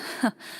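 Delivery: short soft laugh; light amused chuckle. Real, not acted. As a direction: chuckle at the thought of spoiling it. Amused at spoiling the fun.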